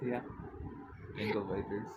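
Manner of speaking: usual speaking voice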